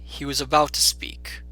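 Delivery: usual speaking voice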